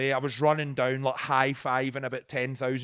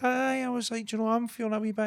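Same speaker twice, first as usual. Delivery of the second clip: high nervous voice; 10 semitones higher, faster than usual. Retold or imitated. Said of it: "And I was like".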